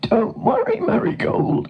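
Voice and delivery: very high whinnying voice; reassuring horse. A narrator's reading. Nathan the horse to the stuck cow, reassuring whinny. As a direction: neigh it out, finishing with a snort.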